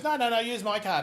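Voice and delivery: a hint of Brie's eager offer; Brie insisting. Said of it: "Brie goes".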